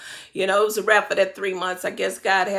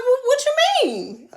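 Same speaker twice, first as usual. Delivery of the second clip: confused; 14 semitones higher than usual. Retold or imitated. Retold confused reply.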